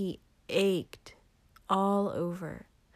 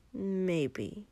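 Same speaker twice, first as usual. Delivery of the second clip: ordinary delivery, nothing marked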